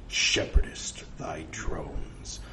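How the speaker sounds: slow, hushed whisper; whispered oracle commandment; ominous voice from beyond. Acted. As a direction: breathe the command slowly and mysteriously.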